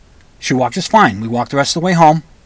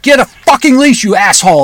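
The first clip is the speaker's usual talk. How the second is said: raised, higher and louder yell; retold angry yell; 8 semitones higher, 5 dB louder than usual. Pissed-off owner yelling back at the stranger, retold angry yell. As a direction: yell the insult, pitched up high. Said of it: "And I yelled at it".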